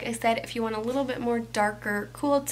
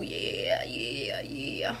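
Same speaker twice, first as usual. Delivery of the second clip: much lower, drawn-out pleased oh yeah; low delighted groan; 5 dB quieter, much slower than usual. Acted. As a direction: sink the voice way down in delight. Reacting to intense highlight glow.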